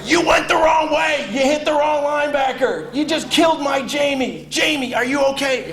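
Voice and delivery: high shouting coach voice; furious coach. Retold or imitated. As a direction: blast the freshman for the wrong block. Coach scolding the freshman.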